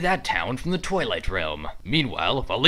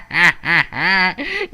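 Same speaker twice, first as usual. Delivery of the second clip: higher, louder villain cackle; gloating laugh; 4 semitones higher, 6 dB louder than usual. Acted. Villain cackles at viewers left hanging, gleeful cackle.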